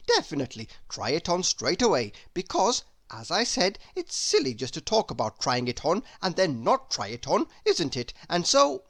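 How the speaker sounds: breathless, eager chatterbox rambling on; a silly, delighted gossip who can't stop talking; chattering Mr Chatterbox. Acted.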